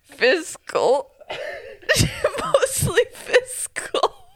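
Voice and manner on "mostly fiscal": high, slow, teary giggle through the words; can barely get the word out for laughing; giggly laughing. Real, not acted. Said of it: "I'm crying".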